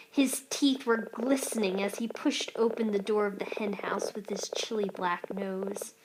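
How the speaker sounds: plain reading voice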